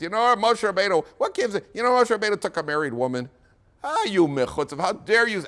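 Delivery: high, loud indignant outcry; outraged accusation. Acted. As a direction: whip the room into outrage. Korach stirs crowd in outrage.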